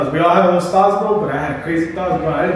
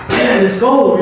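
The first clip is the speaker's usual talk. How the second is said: loud happy cheer, pitched up; excited cheer; 6 semitones higher, 4 dB louder, slower than usual. Retold or imitated. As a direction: celebrate the pass out loud. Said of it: "I got so happy".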